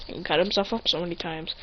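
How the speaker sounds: plain reading voice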